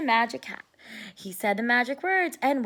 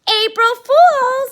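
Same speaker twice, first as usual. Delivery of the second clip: gloating April Fool; 12 semitones higher, 12 dB louder, slower than usual. Acted. Francine gloats over her prank.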